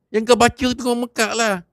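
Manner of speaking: high sharp angry comeback; angry retort. Acted. A young man retorts at Haji Hamid, high and angry. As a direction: throw the blame right back.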